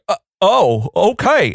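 a slow-witted Southern listener catching on; dumb Southern accent. Retold or imitated. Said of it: "make them sound so dumb with the Southern accent".

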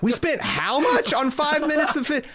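high-pitched, incredulous budget rant; mock outrage, pitch leaping up on the questions; outraged producer. Studio boss balking at the water bill, outraged.

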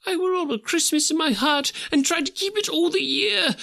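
Scrooge, vowing. Acted. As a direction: swear it with all his heart. Scrooge vows to the spirit.

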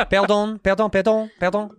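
put-on high-pitched comic French accent; mock French accent. Acting out being asked his name, high mock French accent. Acts out meeting the oddly named man.

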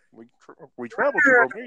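high, quick cartoon puppet-frog imitation; Kermit the Frog. Podcaster mimicking a TV monster hunter for co-hosts, Kermit-like voice. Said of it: "sounded a little bit like Kermit the Frog".

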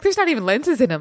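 quick mock boast; mock excited Keanu. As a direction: blurt it out like it's a revelation. Keanu quoted, mocking.

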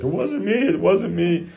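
dad mimicking his crying daughter's denial; imitating crying child. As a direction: imitate a tearful little girl pleading. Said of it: "like, crying".

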